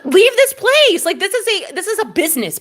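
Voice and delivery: high, loud, insistent telling-off; indignant order. Retold or imitated. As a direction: order him out, pitched high. Acted ordering the man out.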